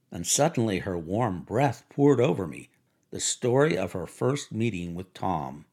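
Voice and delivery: usual speaking voice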